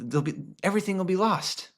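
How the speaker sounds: ordinary delivery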